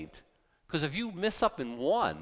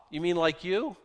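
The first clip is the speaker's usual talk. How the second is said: usual speaking voice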